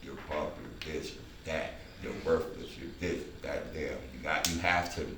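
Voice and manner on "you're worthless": ordinary delivery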